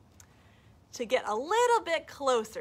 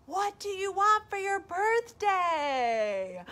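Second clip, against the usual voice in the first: high, slow echoed question; 8 semitones higher, 4 dB louder, slower than usual. Acted. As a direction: repeat it like a far echo. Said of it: "the echo came back".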